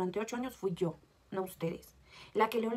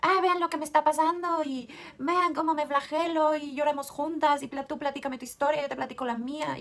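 mock self-pity; 4 semitones higher, 6 dB louder than usual. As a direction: ham up a dramatic sharing-circle sob story. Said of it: "Yo soy muy de".

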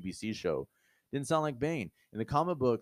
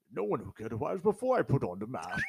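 put-on Bane's line in a Sean Connery-style British accent; mock the villain with a Sean Connery British accent; 3 semitones higher than usual. Podcaster mocking Bane's line in a Sean Connery-style British accent. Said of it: "is this Bane or fucking Sean Connery".

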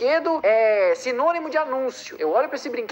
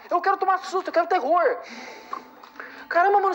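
normal talking voice

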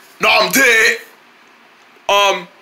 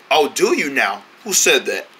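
deep mysterious voice; 9 semitones lower, 4 dB quieter than usual. Acted. Mysterious voice appears.

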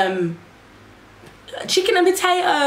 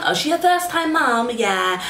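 high imitated African accent; mimicking the African midwife, dismissive; African nurse; 3 semitones higher, slower than usual. Midwife telling colleague she's a first time mum, mock African accent. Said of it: "She's like".

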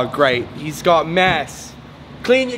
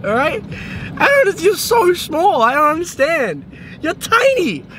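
exasperated scolding; 10 semitones higher than usual. Acted. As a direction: scold her with disbelief. Scolding absent friend Liv.

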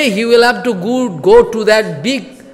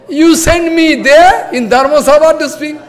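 imitating crying reply; 7 semitones higher, 5 dB louder than usual. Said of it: "started crying".

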